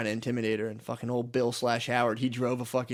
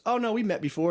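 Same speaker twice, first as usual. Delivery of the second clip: pitched well up, acted apology; imitated awkward politeness; 9 semitones higher than usual. Imitating a neighbor meeting the girl, mock polite. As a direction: play the polite neighbor, voice lifted high. Acts out neighbor correcting himself.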